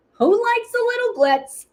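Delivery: high, loud, teasing question; playful call-out. Real, not acted. Asking viewers who likes glitz, playful high call-out. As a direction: toss it out high and excitedly.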